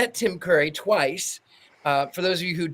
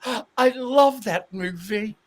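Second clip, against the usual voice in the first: high, slow, drawn-out British delight; slow, higher-pitched celebrity impression; Tim Curry; 6 semitones higher than usual. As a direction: imitate a British actor savouring a compliment. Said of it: "And Tim goes".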